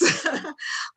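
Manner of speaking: high, short nervous giggle; nervous laugh. Real, not acted. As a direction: laugh off the jitters. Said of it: "I'm a little nervous".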